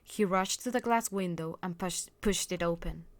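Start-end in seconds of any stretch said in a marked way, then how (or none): none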